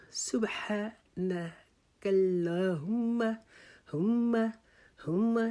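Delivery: سبحانک اللہم drawn out slowly, whispered, ح held; unhurried, each letter full; slow correct recitation. Shown as a demonstration. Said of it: "جلدی سے سبحانک اللہ نہیں پڑھ لینا". (آہستہ اور صاف سبحانک اللہم)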